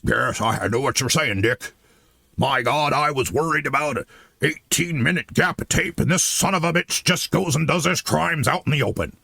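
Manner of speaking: low, gruff mock Nixon voice; Nixon impression. To Cheney in hell, a low gruff mock Nixon. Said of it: "Nixon impression's not the best".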